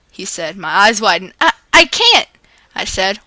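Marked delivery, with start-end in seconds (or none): 1.4-2.3 panicked stammer. Acted. Character panics over the dare.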